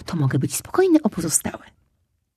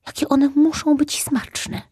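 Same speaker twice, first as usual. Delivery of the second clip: much higher, slow dreamy voice; longing cub; 9 semitones higher, slower than usual. Acted. Cub tempted by the gift eggs, dreamy longing. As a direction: savour each word hungrily.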